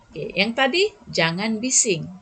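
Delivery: ordinary talk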